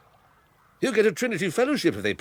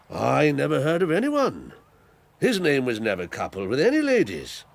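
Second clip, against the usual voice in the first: ordinary talk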